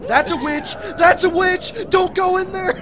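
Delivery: high, loud warning yell; urgent shout. Real, not acted. Warning teammate about witch.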